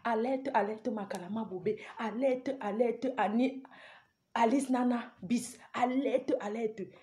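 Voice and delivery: hushed, toned-down slogan; soft roll call, holding the shout back; speaking softly. Shown as a demonstration. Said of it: "je vais parler doucement".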